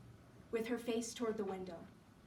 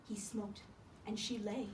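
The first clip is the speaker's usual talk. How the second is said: ordinary delivery, nothing marked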